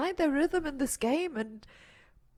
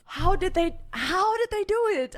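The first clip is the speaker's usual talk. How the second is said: high, loud how-did-they; disbelieving exclaim; 9 semitones higher, 3 dB louder than usual. Real, not acted. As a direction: ask in stunned disbelief. Disbelief at clutch.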